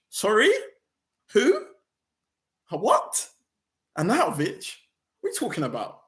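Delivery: can't believe the news; incredulous shock. Real, not acted. Fan reacting to the Arnautovic bid, stunned disbelief.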